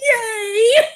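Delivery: high, drawn-out squeal of glee; gleeful cheer. Acted. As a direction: cheer like you are prancing, very high. Character prances over excitedly.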